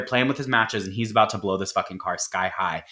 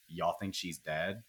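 low, much softer mock wondering; hushed question, imitated; 5 semitones lower, 13 dB quieter than usual. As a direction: ask it under your breath, as the passengers. Passengers wondering after the crash.

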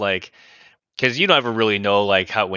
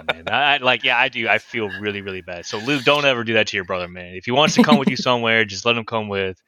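usual speaking voice

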